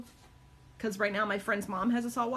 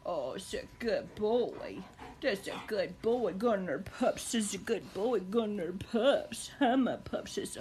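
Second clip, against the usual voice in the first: tender praise; slower than usual. Real, not acted. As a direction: praise the dog lovingly. Praising her dog tenderly.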